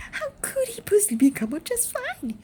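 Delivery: high, sharp snap of a frightened mother; mother snapping at Wonka over her son. Acted. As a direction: bite the question off at him. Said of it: "snapped Missus Gloop".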